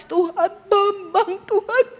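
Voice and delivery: high, drawn-out pretend sobbing plea; exaggerated tearful begging in prayer; mock crying prayer. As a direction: wail it slowly to God, hammed-up. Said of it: "saya nangis".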